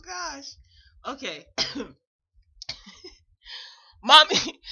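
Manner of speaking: ordinary delivery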